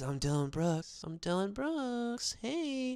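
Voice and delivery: hushed mock trash talk; mock trash-talk whisper. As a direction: taunt quietly into an ear. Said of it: "whispers in their ear".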